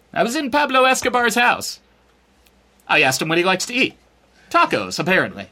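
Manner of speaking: hammed-up scoop from the drug lord's house; mock reporter. Mock reporter bragging about cartel story.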